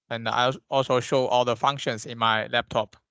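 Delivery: ordinary talk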